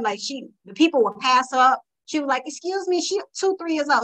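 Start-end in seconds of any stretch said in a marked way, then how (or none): 2.4-3.0 put-on high, sweet little-girl voice; a toddler politely stopping shoppers, pitched high; little daughter's voice. Voicing three-year-old daughter.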